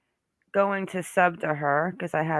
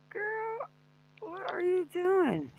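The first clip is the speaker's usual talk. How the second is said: put-on squeaky high "Girl, what are you doing?"; mock high-pitched reply; 14 semitones higher, 6 dB quieter, slower than usual. Teasing Hummingbird about her vape, high and squeaky. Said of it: "And I'm like".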